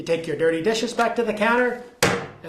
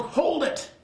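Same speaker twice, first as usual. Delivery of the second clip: mock yell; 10 semitones higher than usual. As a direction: imitate an officer yelling from a doorway. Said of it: "not sure if that's how he sounded".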